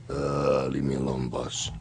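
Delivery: very low, soft rumbling giant; deep giant voice. Retold or imitated. Andre answering the bartender.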